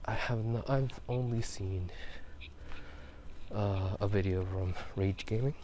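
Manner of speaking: ordinary talk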